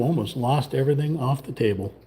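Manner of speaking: ordinary delivery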